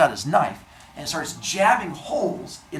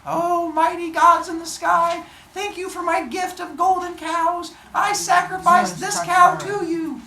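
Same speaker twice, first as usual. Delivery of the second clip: grand ceremonial invocation, pitched way up; mock solemn prayer; 5 dB louder than usual. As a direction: intone loudly on one high note. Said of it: "he does his little prayer".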